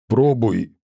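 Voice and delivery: curt order from a monster; commanding tone. Acted. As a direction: give it as a blunt command. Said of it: "в приказном тоне сказал он". (приказным тоном)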